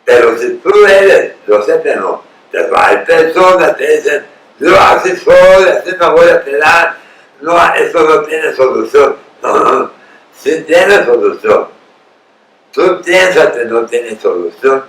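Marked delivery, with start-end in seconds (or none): 4.6-9.1 higher and louder, a mocking voice for the stubborn; mock stubborn quote. Preacher mimics people who refuse to change, mocking stubborn voice. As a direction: toss off the excuse like a stubborn know-it-all.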